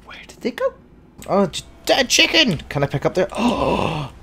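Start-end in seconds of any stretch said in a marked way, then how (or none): none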